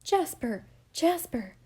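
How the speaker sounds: calling his name, high and loud; a desperate double cry. Acted. Alice cries out to stop Jasper's anger. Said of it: "cried Alice".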